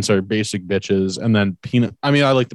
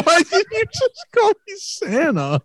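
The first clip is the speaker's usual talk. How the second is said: laughing disbelief. Real, not acted. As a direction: laugh out the question.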